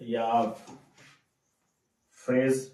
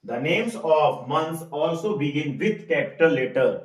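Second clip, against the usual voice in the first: very slow, louder rule read for notes; slow dictation; 6 dB louder, much slower than usual. Shown as a demonstration. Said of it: "reads rule for class".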